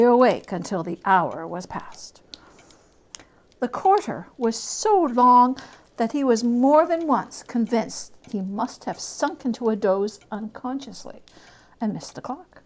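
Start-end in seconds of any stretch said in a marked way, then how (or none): none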